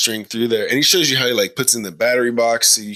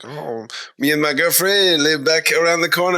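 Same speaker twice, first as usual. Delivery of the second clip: higher, lightly imitated French accent; fake French accent; 6 semitones higher than usual. Retelling a stranger's small talk, fake French accent. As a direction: mimic a dubious French accent. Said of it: "doing a fake French accent".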